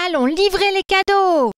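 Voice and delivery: eager exclamation. A narrator's reading. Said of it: "s'écria aussitôt Madame Reine".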